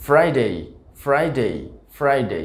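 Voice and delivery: ফ্রাইডে ending in a gliding "dey"; full ei diphthong closing ফ্রাইডে; correct pronunciation. Shown as a demonstration. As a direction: say ফ্রাইডে as "FRY-day". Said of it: "সঠিক উচ্চারণটা".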